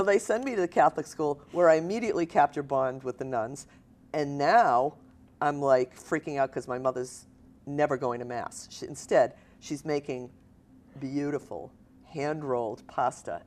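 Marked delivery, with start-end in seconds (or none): none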